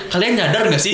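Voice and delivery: high campaign rally voice, laughing; mock the rally speaker, high; booming motivational speech. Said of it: "Prabowo tuh di kampanye-kampanye". (pidato kampanye yang menggebu sambil tertawa)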